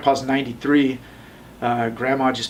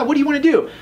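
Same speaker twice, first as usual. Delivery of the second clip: mock eager landlord; 13 semitones higher, 4 dB louder, faster than usual. Mocking eager landlord on phone.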